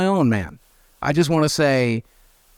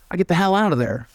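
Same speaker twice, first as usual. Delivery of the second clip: ordinary talk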